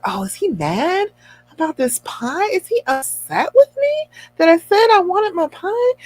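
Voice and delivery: high, anxious retold self-questioning; fretful worry. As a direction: fret aloud, pitch climbing. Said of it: "I was fearful that he was angry".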